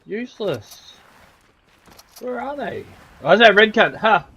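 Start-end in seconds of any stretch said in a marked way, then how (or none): 3.2-4.3 excited shout. Real, not acted. Spotting an enemy mid-fight.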